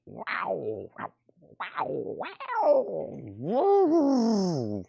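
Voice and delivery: high wailing mouth-guitar wow sliding up. Retold or imitated. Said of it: "my best [name] impersonation of his guitar and his vocals".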